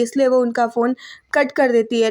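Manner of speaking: ordinary talk, nothing marked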